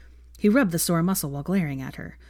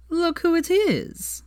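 much higher, slow sing-song tease; gleeful Zabini; 13 semitones higher, much slower than usual. A narrator's reading. Zabini spots a newcomer, gleefully teasing. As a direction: savour it slowly, pitched up.

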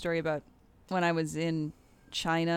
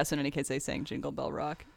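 usual speaking voice

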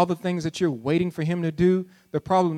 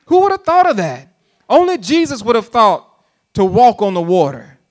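rising, high, ever louder preacher cries; higher and louder, climbing to a peak; preaching build-up; 5 semitones higher, 6 dB louder than usual. Acted. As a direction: build the wonder to a shout. Preacher builds in pitch and volume.

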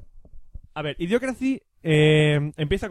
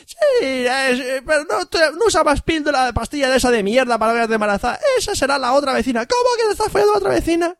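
much higher, whiny hillbilly voice; 9 semitones higher, 3 dB louder than usual. Retold or imitated. Pelea de paletos. (discusión de paletos, voz muy aguda y chillona)

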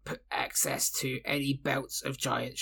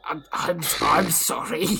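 hushed then louder offended stammer; mock indignation; 2 semitones higher, 7 dB louder than usual. Half-orc haggling with shopkeeper, mock offended. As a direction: play it hurt by the lowball offer.

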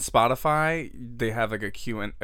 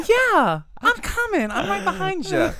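sweet eager voice; 7 dB louder than usual. Retold or imitated. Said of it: "I'd be like".

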